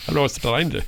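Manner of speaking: badly faked Indian accent. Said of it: "I'll really fuck that up".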